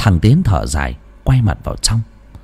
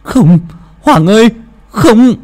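piercing cry of no, much higher and louder; anguished scream; 13 semitones higher, 6 dB louder, slower than usual. Acted. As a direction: scream as the friend dies. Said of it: "thì hét lên". (gào thét đau đớn)

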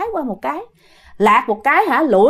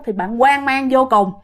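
ordinary delivery, nothing marked

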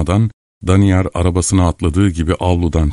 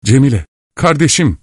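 higher, mildly raised cry; exclaiming; 6 semitones higher than usual. A narrator's reading. Soldier gripping Cemile's hands, a mild high exclamation. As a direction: call out eagerly, without a full shout. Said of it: "diye bağırdı".

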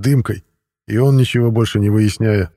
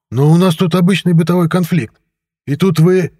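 high, agitated excuse; protesting director; 8 semitones higher, 3 dB louder than usual. A narrator's reading. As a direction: plead that it is a minor matter. (оправдываясь, высоким голосом)

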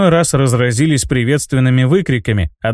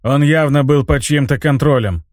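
ordinary delivery

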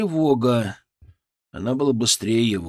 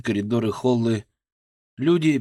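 usual speaking voice

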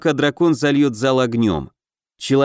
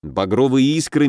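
plain reading voice

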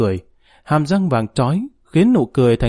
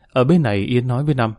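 ordinary delivery

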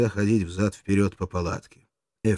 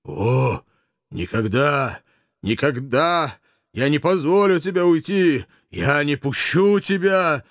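higher, louder, slow last line; passionate refusal; 7 semitones higher, 4 dB louder, slower than usual. Acted. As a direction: forbid her leaving with passion. German refuses, pleading.

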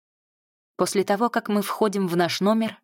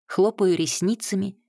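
usual speaking voice

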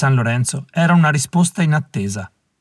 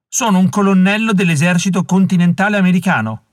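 protesting; 6 semitones higher, 3 dB louder than usual. A narrator's reading. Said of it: "aveva protestato".

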